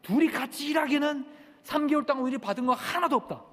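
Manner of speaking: high, forceful heckle, reenacted; shout from crowd. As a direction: call out a protest from the back. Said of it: "뒤에서 사람이 막 소리 지릅니다".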